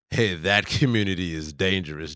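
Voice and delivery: TV man. Retold or imitated. TV man warning viewers about a community, lightly voiced. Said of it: "the T V man said".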